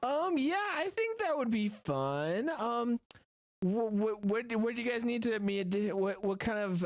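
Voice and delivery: high, bright-eyed character voice; eager applicant. Acted. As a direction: agree in a high, keen voice. Player's character agrees to audition.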